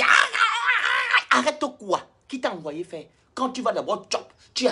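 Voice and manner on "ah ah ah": imitated gagging cries. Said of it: "Tu dis".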